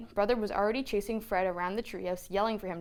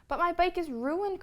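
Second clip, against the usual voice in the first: higher, upset outcry; cried out; 11 semitones higher, 3 dB louder than usual. A narrator's reading. Brother protesting to Mama about his bike, upset cry. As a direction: wail about the ruined bike. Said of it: "cried Brother".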